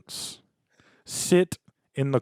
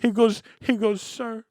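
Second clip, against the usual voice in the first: Italian dad; 9 semitones higher than usual. Pretend. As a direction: scold the date like an old-school Italian dad. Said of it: "is this how Italian men".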